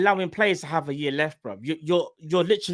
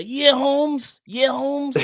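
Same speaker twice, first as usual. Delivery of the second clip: higher, put-on Mexican-American slang voice; 10 semitones higher, much slower than usual. Joking with his co-host about impressions, hammed-up Mexican-American accent. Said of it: "go stereotypical".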